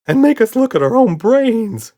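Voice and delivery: wheezing. A narrator's reading. Red adds to the list, high wheeze. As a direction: wheeze it in fright. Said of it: "wheezed Red".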